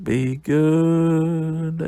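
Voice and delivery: long, stretched "goood" in a playful voice; drawn-out sign-off. Pretend. Reviewer says goodbye to viewers, playfully drawn out.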